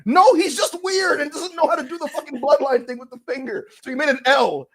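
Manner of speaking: high, insistent rant; exasperated debunking. Real, not acted. Host dismissing the fan theory, exasperated. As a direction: burst in to correct them.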